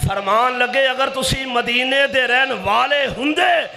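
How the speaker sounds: forceful, high-pitched preacher's retelling; full-force preaching. Retold or imitated. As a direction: retell a stern rebuke in a preacher's ringing voice.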